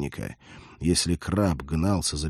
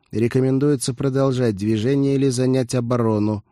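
even, higher robotic monotone; cyborg voice; 4 semitones higher, 4 dB louder than usual. A narrator's reading. Cyborg advises the fleeing lieutenant, robotic monotone. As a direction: report like a machine, on one raised note. Cyborg gives a tactical report.

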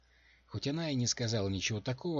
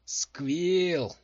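loud, raised angry shout; growled rebuke; 9 semitones higher, 8 dB louder than usual. A narrator's reading. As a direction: snarl the name to silence him. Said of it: "зарычала Дженси".

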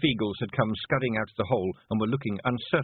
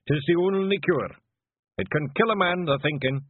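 raised, knowing Scots clansman voice; Scottish clansman; 7 semitones higher, 4 dB louder than usual. Acted. Clansman teasing his chief about long words, mocking. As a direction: needle the chief in broad Scots.

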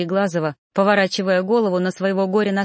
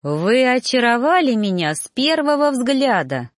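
higher, lilting flattery; effusive gallantry; 6 semitones higher, 3 dB louder than usual. A narrator's reading. Reaper courts the new trainee, effusively charming. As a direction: pour on the charm with big pitch swoops. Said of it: "распинался жнец".

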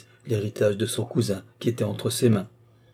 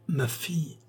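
weak dying voice; 7 semitones higher, 5 dB quieter than usual. A narrator's reading. Dying marquis repeats his answer.

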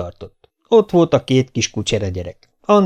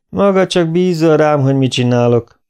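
nyers, kissé emelt hangú visszautasítás; utasítsd rendre nyersen; 5 semitones higher, 4 dB louder than usual. A narrator's reading. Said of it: "mondta nyersen doktor Pankotai". (kurta, nyers válasz)

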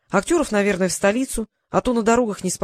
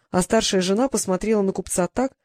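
usual speaking voice

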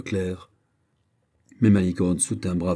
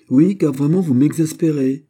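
high, loud exasperated outburst; acted fit of exasperation; 7 semitones higher, 7 dB louder than usual. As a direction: lose patience, voice raised and pitched up. Lovers' quarrel, she is exasperated.

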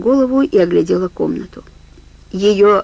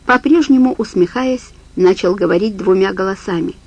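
ordinary delivery, nothing marked

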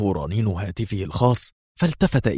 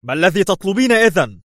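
very high, loud exasperated question; intensely agitated; 11 semitones higher, 5 dB louder than usual. A narrator's reading. Man demanding what blackmailer wants, highly agitated. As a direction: explode in nervous exasperation. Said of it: "قال في عصبية شديدة".

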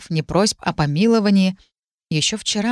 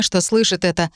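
usual speaking voice

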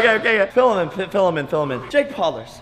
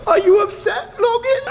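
taunting. Acted. Taunting his brother on camera.

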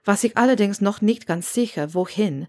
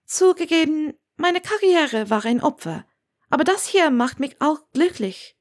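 put-on higher, lively young woman's voice; young witch Hermione, defiant; 8 semitones higher than usual. A narrator's reading. Hermione defends her life to Severus, higher and lively. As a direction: speak up brightly for her as she defends her choice.

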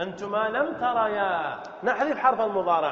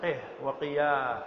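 slow, soft قه وقيا; slow model words; 7 semitones lower, 5 dB quieter, much slower than usual. Shown as a demonstration. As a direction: read قه وقيا slowly as examples. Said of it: "وسيأتي أحكامه". (قه وقيا ببطء)

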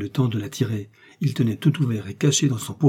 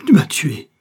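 loud, higher-pitched accusation; stricken cry; 5 semitones higher, 8 dB louder than usual. A narrator's reading. Buckingham to Felton who stabbed him, loud stricken cry. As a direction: cry it out at your killer. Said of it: "cria Buckingham".

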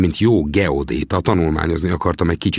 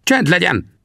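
high, sharp order for silence; stern rebuke; 13 semitones higher, slower than usual. Acted. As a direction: shut the young man up firmly. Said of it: "rivolt rám Sam olyan erélyesen".

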